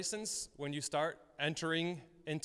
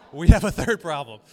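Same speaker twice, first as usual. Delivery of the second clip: punchline repeated much louder; 17 dB louder than usual. Retold or imitated. Pastor repeating his punchline louder to the congregation. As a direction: hammer the punchline home loudly.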